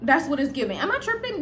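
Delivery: ordinary talk, nothing marked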